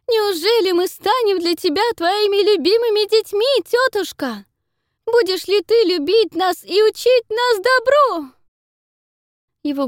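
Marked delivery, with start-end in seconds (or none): 0.1-8.3 very high, loud falsetto wail; boy playing a sobbing girl. A narrator's reading. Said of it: "рыдающим голосом говорит".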